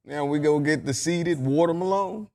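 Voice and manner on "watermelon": WATERMELON ending in a long "lone"; own pronunciation. Shown as a demonstration. Guest uses the new word in a sentence, WATERMELON as "water-muh-lone". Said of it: "but I say watermelon".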